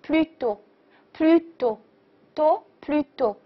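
"plus do" said slowly with a clear French u; model pronunciation. Shown as a demonstration. Teacher shows the French U sound, slow model pronunciation. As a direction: sound out "plus do" slowly, with pauses between takes. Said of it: "这个是U的发音".

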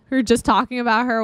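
ordinary delivery

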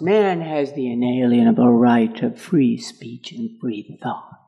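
a hint of ministers' solemn reading; mock solemn creed reading. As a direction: recite it like a minister reading scripture.

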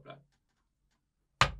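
normal talking voice

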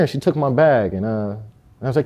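usual speaking voice

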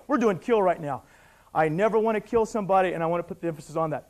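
normal talking voice

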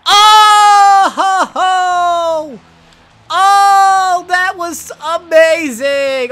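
very high, loud shouts broken by laughter, slow on the big line; screaming with delight at an inside-the-park homer; ecstatic shouting. Real, not acted. Gamer celebrating an inside the park home run, screaming with joy.

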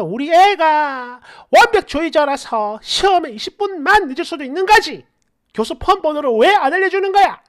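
high, indignant mock-mother voice; pushy mother. Mother demanding professor's phone number, pushy and indignant. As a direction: whine it high, speeding into an exasperated demand. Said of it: "이랄지 했을 듯".

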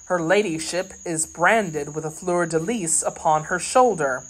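usual speaking voice

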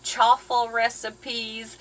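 ordinary talk, nothing marked

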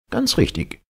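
high-pitched professor; professor's voice. A narrator's reading. Professor confirming the captain's figures, high character voice.

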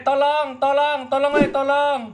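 high, loud desperate cries; screaming for help. Acted. Man chased by zombies screaming for help, high and loud. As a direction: yell for help while fleeing.

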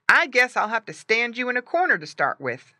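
a hint of a boy coaching his pet; boy talking. A narrator's reading. Boy's speech voiced in story.

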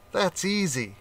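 a hint of a clever rat; rat's answer. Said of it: "she said".

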